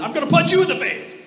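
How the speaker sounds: high, loud mock outburst; over-the-top crazy threat. Parent threatening a child over the top, mock crazy. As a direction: blurt a hammed-up crazed threat. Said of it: "over the top crazy".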